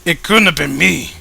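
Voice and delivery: loud, defensive character denial; loud voice of a character denying blame. Acted. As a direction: insist you weren't there.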